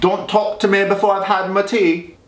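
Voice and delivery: badly faked British accent; mock British accent. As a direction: mimic her British accent, hammed-up. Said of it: "I cannot do accents well".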